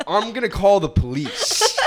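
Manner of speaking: loud, high mock threat; retold shout back. Driver to yelling Russian lady, exasperated mock shout. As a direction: shout it over the tirade, half laughing.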